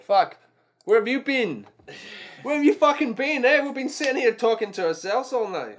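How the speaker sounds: real excited outburst at new viewers; excited shout. Real, not acted. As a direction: greet them like long-lost mates. Greets viewers finally arriving online.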